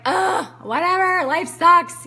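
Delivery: loud, high-pitched groaning dismissal; exasperated griping, pushed up and out; pissy. Retold or imitated. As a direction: reenact a sulky, fed-up complaint. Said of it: "I was really pissy".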